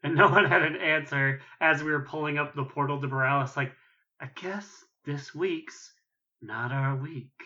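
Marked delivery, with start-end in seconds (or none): 4.2-7.3 mock defeated, slow and soft; Lynn. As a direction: give up slowly, imitating a beaten leader. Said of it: "a very defeated Lynn was like".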